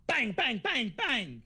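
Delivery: bang bang, punchy and high; gunshot sounds. Acted. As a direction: spit out the gunshots. GM voices gunshots.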